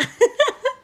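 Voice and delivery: sudden high-pitched laugh; high laugh burst. Real, not acted. Recalling the prank on her drunk husband, high laugh burst.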